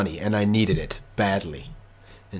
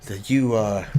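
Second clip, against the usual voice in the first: ordinary talk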